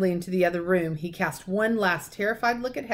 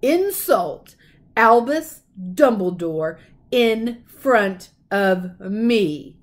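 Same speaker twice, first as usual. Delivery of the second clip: loud, very slow, booming; furious giant's warning; thundered; 8 dB louder, much slower than usual. A narrator's reading. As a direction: drag it out, menacing. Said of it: "he thundered".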